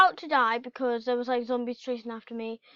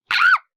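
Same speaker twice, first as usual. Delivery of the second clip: startled high scream; 13 dB louder than usual. Real, not acted. Player jumping at a sudden noise, real scream. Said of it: "That scared me!".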